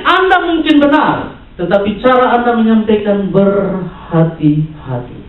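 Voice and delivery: high, insistent sermon voice; forceful preaching. Real, not acted. Preacher telling the church to keep unity, insistent preaching. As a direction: press the point on the congregation.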